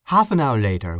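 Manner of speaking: ordinary delivery, nothing marked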